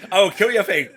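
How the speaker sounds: high, loud mock screaming threat; imitated scream. Co-host reenacting the serious moment, imitated screaming. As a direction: scream it like a film character ready to kill. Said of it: "He's, like, screaming".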